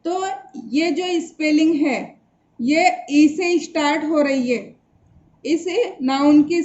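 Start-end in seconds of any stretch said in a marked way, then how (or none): none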